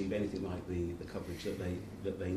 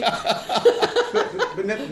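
laughing; 13 semitones higher, 13 dB louder, much slower than usual. Real, not acted. Laughter after gibbering idiots joke.

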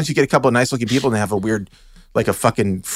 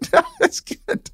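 high squealing laugh through words; slower than usual. Real, not acted.

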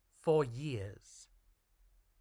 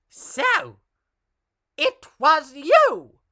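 high, slow witch screech; screaming witch; 11 semitones higher, 11 dB louder, much slower than usual. A narrator's reading. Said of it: "screamed Horabella".

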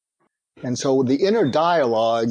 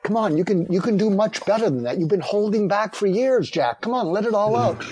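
urging; 7 semitones higher than usual. Retold or imitated. Wife urging husband to vent.

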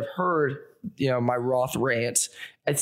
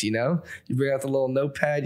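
usual speaking voice

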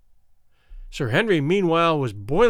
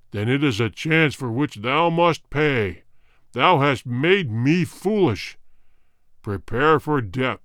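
slow, deep, cold king's sentence; warrior king; 4 semitones lower than usual. Acted. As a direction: pass sentence without pity. King condemns the soldier.

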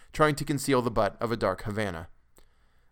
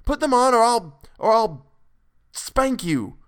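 much higher and louder, fiercely angry; father fiercely snapping back at his old son; fierce retort; 11 semitones higher, 7 dB louder than usual. A narrator's reading. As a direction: hurl it back in a fury. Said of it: "retorted Mister Button fiercely".

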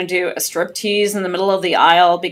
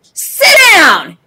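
higher and louder, a strict teacher laying down the law; stern teacher voice; 7 semitones higher, 4 dB louder than usual. Retold or imitated. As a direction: command him like a fed-up high school teacher. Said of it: "in my best teacher voice".

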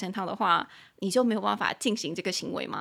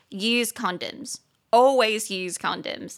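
slow, loud stress on "use" and "always"; emphatic repetition; 4 dB louder, much slower than usual. Shown as a demonstration. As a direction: drive home "always use condoms". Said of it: "本台要再重申一次立场".

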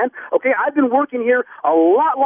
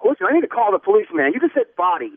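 ordinary delivery

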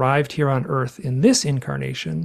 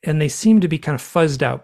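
ordinary delivery, nothing marked

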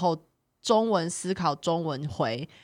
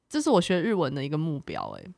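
ordinary delivery

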